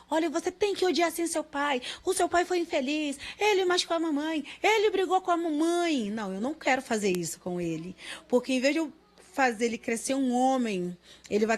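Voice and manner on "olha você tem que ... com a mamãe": higher, insistent mock mother urging her son. Mother voicing the hatred she refuses to teach her son, higher and pushy. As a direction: pitch it up and push, as if urging him to hate. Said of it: "de incentivar ele".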